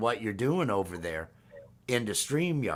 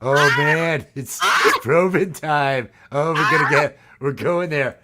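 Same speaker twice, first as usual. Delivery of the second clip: loud hyped-up yelling, laughing; excited shouting; 2 semitones higher, 10 dB louder than usual. Acted. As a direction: hype it up, almost shouting. Excited alien bit.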